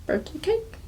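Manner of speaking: put-on high toddler question round a sippy cup; toddler with cup in mouth. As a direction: imitate a two-year-old guessing with her mouth on a cup. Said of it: "sippy cup or something in her mouth".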